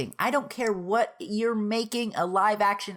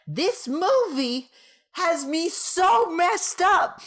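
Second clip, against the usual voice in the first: loud, high, slow disbelief; exasperated outburst; 9 semitones higher, 3 dB louder, slower than usual. Real, not acted. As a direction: wail it out in frustration. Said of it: "I'm just gonna yell at the camera".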